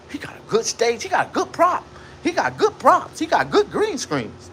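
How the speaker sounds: high-pitched mimicry of skeptics; mocking doubters. Mimics doubters of his shop.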